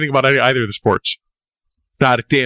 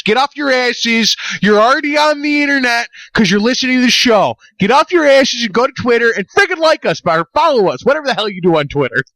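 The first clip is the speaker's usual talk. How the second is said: hammed-up rant at lazy listeners; mock rant at listeners; 7 semitones higher than usual. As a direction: bark at the audience to get off their asses. Host yelling at listeners to follow.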